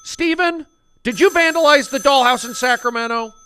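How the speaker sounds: high, loud accusing mom; stern mom. Acted. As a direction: demand an answer loudly. Mom interrogating.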